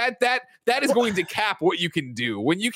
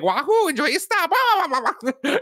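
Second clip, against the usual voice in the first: high, loud Mario bus driver; 13 semitones higher, 3 dB louder than usual. Retold or imitated. Imitating Mario as bus driver to the co-hosts. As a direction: chirp it as a cartoon Mario driver. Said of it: "he'll be like".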